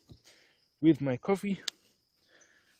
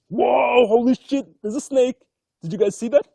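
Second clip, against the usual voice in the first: sudden loud, higher cry of real surprise; startled exclamation; 8 semitones higher, 7 dB louder than usual. Real, not acted. Vlogger spots a snake in the crystal rock, startled loud cry. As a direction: jolt up in pitch and volume at the shock.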